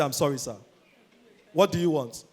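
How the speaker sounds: low, soft deferential waiter. Acted. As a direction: apologise humbly and take the order. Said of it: "Waiter say".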